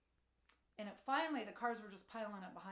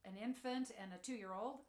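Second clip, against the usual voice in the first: usual speaking voice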